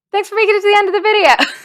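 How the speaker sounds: laughing outro. Real, not acted. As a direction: rush it out through a laugh.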